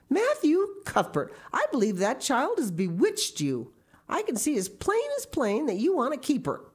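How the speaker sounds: high, scolding disbelief; aghast. A narrator's reading. Marilla accuses Matthew of being bewitched, aghast. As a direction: exclaim it accusingly, pitch swooping high.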